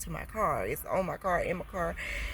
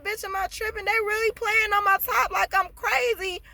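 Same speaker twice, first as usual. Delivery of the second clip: high and louder, asking if she's the crazy one; incredulous outcry; 13 semitones higher, 5 dB louder than usual. Retold or imitated. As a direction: cry out in disbelief. Retold disbelief at hospital.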